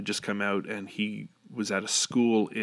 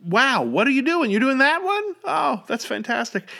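delighted amazement; 7 dB louder than usual. Retold or imitated. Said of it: "And it was like".

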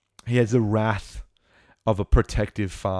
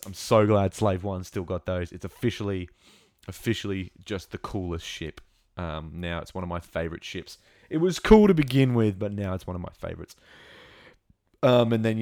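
usual speaking voice

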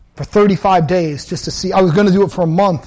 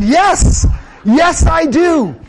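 high, slow, emphatic yes to the son; proudly affirming, pitched up high and stretched out; eager yes; 8 semitones higher, much slower than usual. Retold or imitated. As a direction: lean into it with a drawn-out, delighted yes.

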